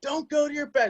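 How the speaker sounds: high sharp yell of warning; coach yelling. Retold or imitated. Coach yells at his wrestler mid-match, high yell. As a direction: call it out across the mat. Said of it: "I yell".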